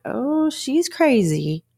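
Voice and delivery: high, slow, mocking "oh, she's crazy"; mocking label. Mimicking how people brush off angry women, a high, slow mock. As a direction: sneer it out the way people dismiss an upset woman. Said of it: "it's kinda like".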